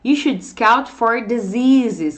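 slow, careful English; deliberately slow teacher reading; slow model sentence. Shown as a demonstration. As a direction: read it slowly for the class. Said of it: "vamos focar aqui, ó".

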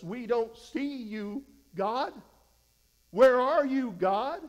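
slow, then a rising loud cry of where are you; crying out to God. Acted. Gideon pleading aloud to an absent God, building to a shout.

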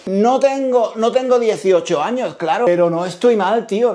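usual speaking voice

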